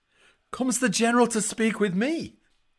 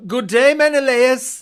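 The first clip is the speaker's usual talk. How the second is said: loud mocking greeting; 4 semitones higher, 7 dB louder than usual. Achilles loudly hails Menelaus.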